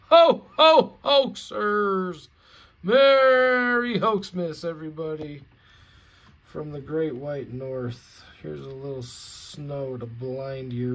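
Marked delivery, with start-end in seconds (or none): 0.1-5.4 booming, slow, higher mock Santa laugh. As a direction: ham up a jolly Santa cheer.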